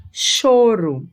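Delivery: teacher's careful demo of "Choro". Shown as a demonstration. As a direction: stress the sh that starts "Choro". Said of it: "som de X".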